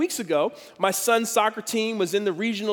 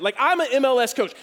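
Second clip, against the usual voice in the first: ordinary talk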